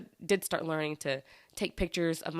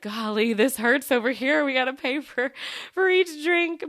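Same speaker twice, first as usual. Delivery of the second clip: exasperated outburst; 6 semitones higher, 6 dB louder than usual. Retold or imitated. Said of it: "I was like".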